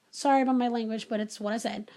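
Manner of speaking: ordinary talk, nothing marked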